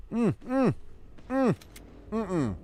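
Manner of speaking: squeaky nervous hums; high-pitched humming. Real, not acted. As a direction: hum it up high, unsure.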